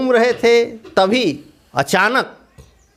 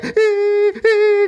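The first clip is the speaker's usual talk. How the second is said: high, thin squeal of fright; frightened squeal; 12 semitones higher than usual. A narrator's reading. Leaf squeals in fear at the wind. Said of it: "करने लगा पत्ता".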